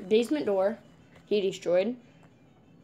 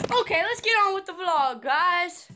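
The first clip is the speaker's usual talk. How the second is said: higher, louder energetic opener; hype vlog intro; 7 semitones higher, 4 dB louder than usual. Real, not acted. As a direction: pump up the viewers.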